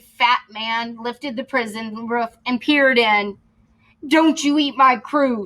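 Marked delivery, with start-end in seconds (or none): 4.1-5.5 defiant call; mild shout. Acted. Captain shouting at Santa, mild defiant shout. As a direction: stand up to the giant. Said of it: "shouted the".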